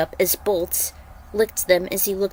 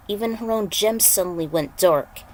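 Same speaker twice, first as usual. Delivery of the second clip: ordinary delivery, nothing marked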